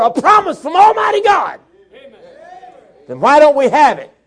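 loud, high fiery preaching; full-force preaching. Acted. Preacher thundering at the congregation about God's power, fiery. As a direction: rouse the congregation.

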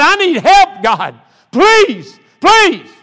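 loud, high cries with spaced-out pleases; desperate plea. Retold or imitated. New convert begging God for help, desperate shouted plea. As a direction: cry out to God, each plea pushed to the top.

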